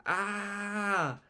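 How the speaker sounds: controlled high-pitched storybook scream; acted scream. A narrator's reading. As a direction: scream in disgust, held back in volume. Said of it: "screamed Margaret, gagging".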